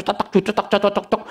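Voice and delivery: soft quick clicking of abacus beads; imitated abacus clicks. Preacher mimics friend on the abacus, soft clicks. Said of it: "Dia main simpo aja".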